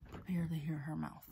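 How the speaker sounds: hushed aside to the camera; whispering. Real, not acted. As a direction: whisper it conspiratorially.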